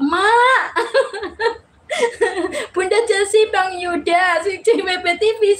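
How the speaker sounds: high, laughing out the names. Real, not acted. Streamer greeting viewers who call her Mak, laughing. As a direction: break into laughter as you greet them.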